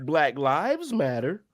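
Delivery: slow, rising like a question on Lives; questioning inflection. Shown as a demonstration. Demonstrating inflection to friends, slow questioning rise.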